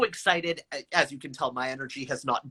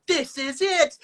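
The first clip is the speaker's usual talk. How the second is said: imagined excitement; 12 semitones higher, slower than usual. Retold or imitated. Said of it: "I thought".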